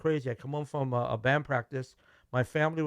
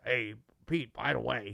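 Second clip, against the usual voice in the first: a lightly voiced bandleader, lower and softer; Leslie's way of talking; 3 semitones lower, 4 dB quieter than usual. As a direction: quietly drop the line the way the bandleader would. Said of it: "that's how Leslie talks".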